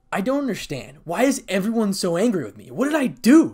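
rising to a loud, high outburst; upset, frustrated. A narrator's reading. Issei alone, not understanding their anger, increasingly upset. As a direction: build from confusion to an anguished yell. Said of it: "as he clenched his fist".

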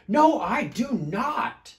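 loud, high, slow refusal; storybook shout. A narrator's reading. Smelly bear arguing back, loud defiant shout. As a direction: shout each word in defiance. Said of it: "shouted Big Smelly Bear".

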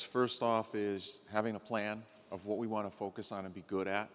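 ordinary talk

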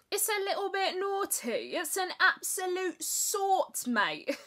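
high-pitched, hammed-up Essex accent; bad Essex accent. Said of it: "such a terrible accent".

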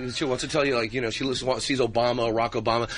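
normal talking voice

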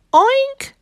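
very high, loud oink; high rising oink; cats' oink. A narrator's reading. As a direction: squeal an oink like a cat pretending. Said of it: "said the cats".

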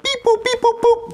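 high robotic beeps; alien voice. Pretend. Kid pitches a squeaky robotic alien voice, high beeps. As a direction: beep it out like a toy robot. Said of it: "speak in an alien voice".

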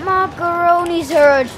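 loud, high cry of alarm; startled exclamation. Real, not acted. Player caught under fire, startled cry. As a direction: burst out, stretched and high, in shock. Reacts to danger in game.

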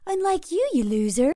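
ordinary talk

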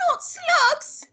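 very high, loud horrified wail; crying out. A narrator's reading. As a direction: cry out as a horrified little boy. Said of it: "George cried out".

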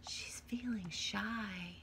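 plain reading voice

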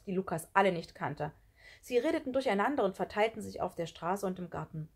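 ordinary delivery, nothing marked

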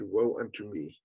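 low, soft, broken murmur of grief; father's hushed self-reproach; quiet lament. Acted. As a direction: breathe it out as a defeated sigh. The father again said.

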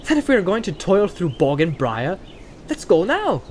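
rally the others to set off; Pippin, eager. A narrator's reading. Pippin's dialogue in read story.

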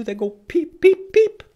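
high squeaky chick peeps; baby chick peeps. Shown as a demonstration. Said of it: "What do they do?".

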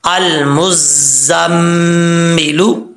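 अल मुज़म्मिलू slow, mm hummed long; nasal ghunna. Shown as a demonstration. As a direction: hold the nasal m of मुज़म्मिलू on a level tone. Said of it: "एक अलिफ की मकदार गुन्ना".

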